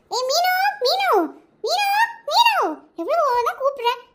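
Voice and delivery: high, loud shouted call; calling out loudly. Acted. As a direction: yell her name across the house. Aunt calling girl from afar.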